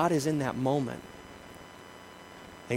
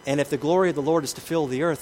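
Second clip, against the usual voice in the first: usual speaking voice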